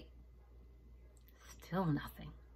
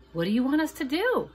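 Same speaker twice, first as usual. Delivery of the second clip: high, louder eager question; piping up; 10 semitones higher, 9 dB louder than usual. Acted. Danny asking Maria what to do, piping up high. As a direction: pipe up like a little boy. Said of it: "Danny piped up first".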